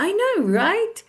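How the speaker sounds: high, animated "I know, right?"; agreeing reply. Acted. Replying to someone who is laughing, eager agreement. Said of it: "So you will reply like".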